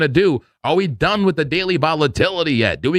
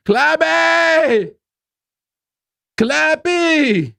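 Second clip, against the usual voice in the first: very high, drawn-out call; 14 semitones higher, much slower than usual. Real, not acted. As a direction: holler the name, stretching the last syllable. Calling out to silent assistant.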